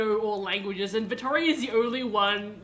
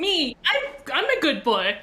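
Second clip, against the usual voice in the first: a hint of an eager dog, higher and louder; excited talking dog; 4 semitones higher, 4 dB louder than usual. Dog answering its owner, lightly voiced eager dog. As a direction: play the dog yapping happily. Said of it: "And Toast goes".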